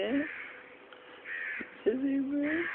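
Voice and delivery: very slow, high, soft question. Real, not acted. Parent asking the child what they are doing, drawn-out coo. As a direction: stretch it out into a high, quiet coo.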